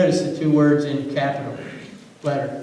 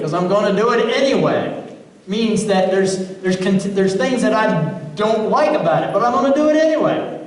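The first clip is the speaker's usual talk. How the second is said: building fervor; 5 semitones higher than usual. Acted. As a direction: build the preaching until it breaks high on "anyway". Preacher building to a climax.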